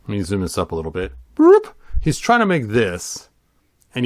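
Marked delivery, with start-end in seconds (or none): 1.3-1.7 high boop sound. Pretend. Zoom sound effect.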